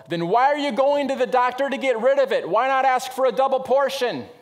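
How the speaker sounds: loud, high mock yell; held-back yell. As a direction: let out the yell he swallowed at the funeral. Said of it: "to not yell".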